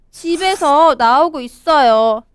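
normal talking voice

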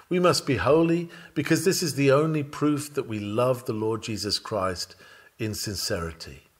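normal talking voice

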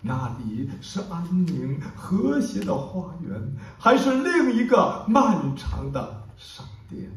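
declaimed verse, swelling into urgent questions and falling back to hush; impassioned poem recitation. Acted. Poet shares a short, powerful poem with an audience, declaimed passionately. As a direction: recite with dramatic surges and hushed pauses.

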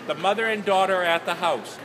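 ordinary delivery

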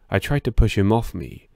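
usual speaking voice

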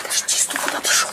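sharp urgent whisper; whispering while hiding. Real, not acted. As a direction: hiss it to stop him. (тревожный шёпот)

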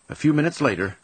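usual speaking voice

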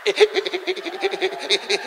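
high, rapid stuttered squeal; high repeated syllable trailing on and on; stammer. Acted. Raft owner stuck mid-sentence, high stammering.

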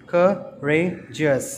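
slow "courageous" in three separate beats; syllable by syllable. Shown as a demonstration. Teacher counting syllables for children, "courageous" slowly split in three. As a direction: break "courageous" into cou, ra, geous with pauses. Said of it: "three syllables".

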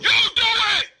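shouted comeback; angry shout. Real, not acted. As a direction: fire it back at her. Heated argument with Dia.

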